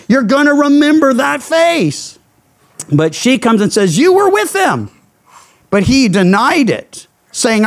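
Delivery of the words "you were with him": put-on a light, higher-pitched accusing girl's voice; a hint of a servant girl calling him out.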